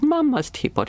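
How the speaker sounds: put-on very high, wailing toddler voice; crying toddler. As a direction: voice a little boy upset about his mum's teapot. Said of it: "He said".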